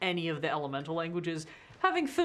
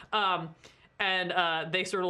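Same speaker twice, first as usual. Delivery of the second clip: ordinary delivery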